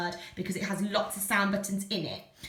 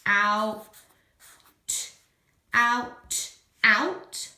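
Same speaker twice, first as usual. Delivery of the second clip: out said louder each time; repeated out; 5 dB louder, much slower than usual. Shown as a demonstration. Teacher stresses out for the children. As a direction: punch out, out, out. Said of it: "repeating out".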